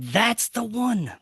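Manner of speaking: slow, high, drawn-out certainty; certain realization. Retold or imitated. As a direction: say it like you just found the place. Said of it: "So I was like".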